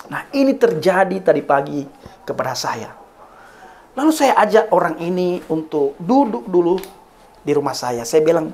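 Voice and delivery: ordinary delivery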